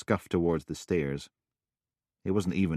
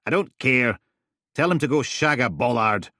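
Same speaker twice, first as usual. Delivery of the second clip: loud, irritated brush-off; snapping; 7 semitones higher, 7 dB louder than usual. Acted. Roberta snapping at Big Gary on the phone. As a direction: snap it dismissively.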